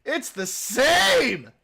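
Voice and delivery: mock high-pitched drawl; high, stretched mock voice. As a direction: squeal it out slowly in mock wonder. Mimics accent sameness, stretched high.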